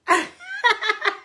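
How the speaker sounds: high, helpless laugh close to tears; real high-pitched belly laugh; laughing till it hurts. Real, not acted. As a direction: laugh so hard it almost turns to crying. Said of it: "je ris jusqu'à mal au ventre".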